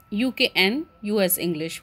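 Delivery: ordinary talk, nothing marked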